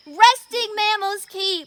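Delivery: loud, slow sign-off call. Real, not acted. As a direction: announce it to the crowd. Calls out sign-off with audience.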